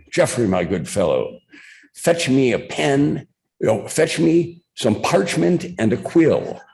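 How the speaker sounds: mock-British lord, rising high and grand; Mike Royko's semi-British accent. Columnist orders bartender to fetch parchment, semi-British accent. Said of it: "speak in a semi-British accent".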